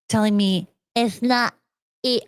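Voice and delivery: normal talking voice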